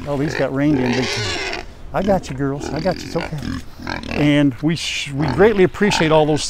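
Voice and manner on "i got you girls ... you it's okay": soothing. Real, not acted. Soothing rained-in hens.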